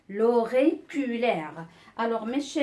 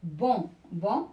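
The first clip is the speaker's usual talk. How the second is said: "bon, bon" as two separate syllables; repeated syllable. Shown as a demonstration. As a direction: sound out "bon", pause, then "bon". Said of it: "une syllabe qui se répète deux fois". (« bon, bon », deux syllabes bien détachées)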